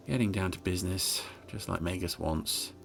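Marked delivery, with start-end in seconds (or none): none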